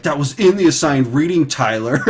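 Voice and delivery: mock an exasperated teacher telling off a student, really loud; super pissed teacher. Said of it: "like, really loud, like, he was super pissed".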